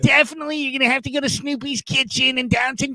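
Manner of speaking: high-pitched, eager voicemail rambler; Ralph. Retold or imitated. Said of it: "it's, it's Ralph".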